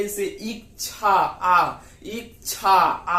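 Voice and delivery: इच्छा drawn out very slowly, the final आ stretched long; teacher sounding out इच्छा to isolate the आ; slow syllable demo. Shown as a demonstration. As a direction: say इच्छा slowly, holding the आ at the end. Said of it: "इसको आप उच्चारण कीजिए".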